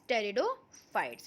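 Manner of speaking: pteridophytes begun on a T sound, P silent. Shown as a demonstration. Teacher showing students how pteridophytes is pronounced, P silent. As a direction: say "pteridophytes" slowly, dropping the P. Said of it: "In this P is silent".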